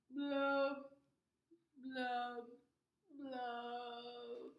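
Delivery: hammed-up crying blub blub; mock sobbing blubs. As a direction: blubber like a sad fish. Fish sobs blub.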